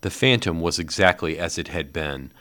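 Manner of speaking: ordinary delivery, nothing marked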